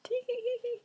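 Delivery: high whispered tee-hee giggle; giggling. Retold or imitated. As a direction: titter like a kid in class. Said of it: "just like".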